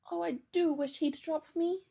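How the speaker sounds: a tiny bear wishing hard; wishful little bear. A narrator's reading. Teddy carried off by the dog, pleading. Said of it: "thought Teddy".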